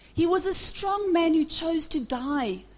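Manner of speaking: ordinary delivery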